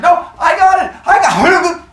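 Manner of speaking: high, loud frantic cry; panicked shout. Retold or imitated. Cronus fumbling the baby mid-fall, high panicked shout. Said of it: "he's like".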